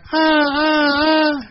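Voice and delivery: very high mock bray; donkey braying. Buried donkey braying as earth covers him. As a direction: keep braying as dirt falls. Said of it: "he kept on going".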